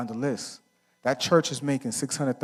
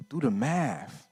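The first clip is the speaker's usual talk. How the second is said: ordinary talk, nothing marked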